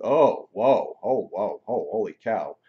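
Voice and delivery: loud, swooping wows of astonishment; amazed exclamation. Retold or imitated. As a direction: act out the healer's stunned reaction, loud. Retells shaman's startled reaction to his energy.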